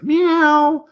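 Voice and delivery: very high mock meow; cat meow. Puppy George meowing like a cat, very high. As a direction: meow like a kitten. Puppy meows instead of barking.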